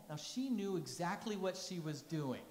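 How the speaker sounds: ordinary talk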